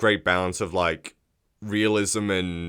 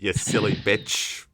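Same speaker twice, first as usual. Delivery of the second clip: slow Aussie insult; mock Australian accent. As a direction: drawl the insult like an Aussie bloke. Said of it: "Australian remake".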